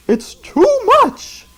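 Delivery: very high, slow exasperated cry; squealed protest; exasperated teacher. Acted. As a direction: throw up hands in dismay. Miss Clavel exasperated.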